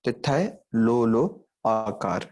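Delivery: तिथय लो with the final vowel drawn out slowly; slow drawn-out demo of the lengthened sound; dragged vowel. Shown as a demonstration. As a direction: stretch the end of तिथय लो and लो आकार. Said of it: "आवाज़ को drag करते वक्त".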